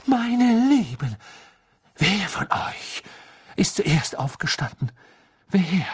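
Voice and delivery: loud then dramatic whisper; frantic mystic alarm; shrieking seer. A narrator's reading. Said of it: "kreischte sie laut auf". (kreischend, dann raunend)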